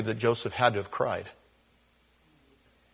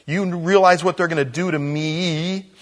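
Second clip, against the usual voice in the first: mock panicked Reuben; 7 semitones higher, 7 dB louder than usual. Reuben frets to his brothers about his own trouble. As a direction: play Reuben as worried for his own skin.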